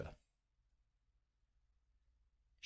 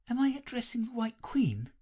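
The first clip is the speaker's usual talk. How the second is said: timid; 13 semitones higher than usual. A narrator's reading. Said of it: "she began rather timidly".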